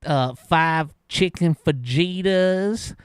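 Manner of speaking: slow, drawn-out ordering voice; imitating the customer. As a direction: drag out a long ridiculous order. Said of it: "let me say it like he did".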